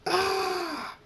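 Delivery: long, very high loud scream; sustained high "ah" scream; character scream. A narrator's reading. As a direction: shriek out in alarm. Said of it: "Draconix screams".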